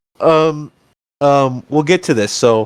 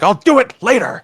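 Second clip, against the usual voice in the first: higher-pitched mock brush-off; dismissive dad; 7 semitones higher than usual. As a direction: imitate a dad fobbing off his kid. Mimicking a busy dad brushing off.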